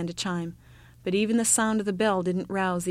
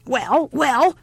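put-on loud, raised old-woman bark; old woman shouting; 6 semitones higher, 7 dB louder than usual. A narrator's reading. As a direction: shout as if a little deaf. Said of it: "shouted the old woman, who was a little deaf".

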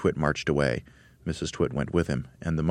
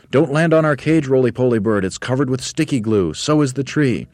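much higher, louder call; shouted warning; 8 semitones higher, 6 dB louder than usual. Acted. Monkeys shouting a warning to the bird. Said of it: "they shouted out".

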